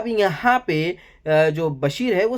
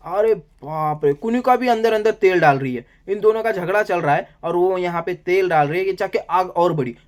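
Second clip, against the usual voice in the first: usual speaking voice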